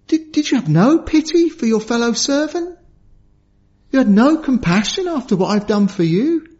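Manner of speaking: the wronged king demanding how the servant could be so merciless; angry king. Acted. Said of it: "He was angry".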